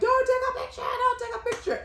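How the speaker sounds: high-pitched mock shout; mock panicked protest. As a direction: frantically imitate someone objecting to a photo. Retelling a man's panicked protest.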